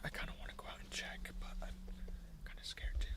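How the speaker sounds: whispering. Real, not acted. Scared whisper in dark tent.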